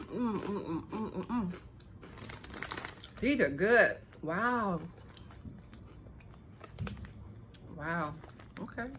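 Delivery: talking while chewing crunchy snack; muffled by food in mouth; mouth full, chewing. Real, not acted. Seen 3: Puts nut in mouth at -2; jaw and lips chewing throughout.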